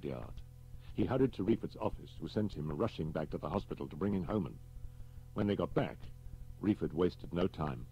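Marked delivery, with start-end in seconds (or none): none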